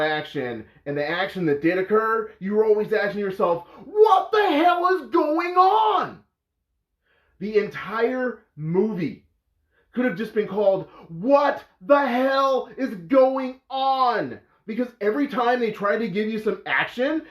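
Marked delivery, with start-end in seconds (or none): 3.9-6.2 high, drawn-out cry of disbelief; exasperated, high-pitched mock yell; bewildered shout. Acts out bewildered viewer's outcry. 11.1-14.4 slow, higher, word-by-word outcry; drawn-out, high-pitched "going on"; exasperated emphasis. Retold or imitated. Mock film title, spelled out emphatically.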